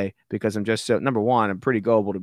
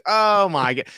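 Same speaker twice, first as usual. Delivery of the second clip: normal talking voice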